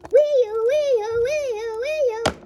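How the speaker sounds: high, loud rising-and-falling wail; police siren. Pretend. Officer coming to arrest him, wailing siren. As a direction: swoop the voice like a siren. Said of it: "since you're the police officer".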